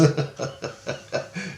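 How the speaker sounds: soft, voiceless chuckle; amused laugh. Real, not acted. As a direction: chuckle at your own odd remark. Laughs at own alien remark.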